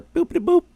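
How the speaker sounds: high electronic boopity boop beeps; scouter beeping. Retold or imitated. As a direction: chirp it like a little robot device. Scouter device going off.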